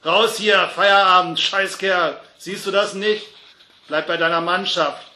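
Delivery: shouted, impatient orders; angry landlord yelling. A narrator's reading. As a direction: bawl out a drunk to throw them out. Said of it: "schrie ihn der Wirt an".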